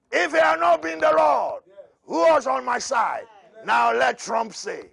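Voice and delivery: loud high preaching cry; shouted psalm. Acted. Preacher shouting the psalm to his congregation, loud and high. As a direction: proclaim it to the congregation at full voice.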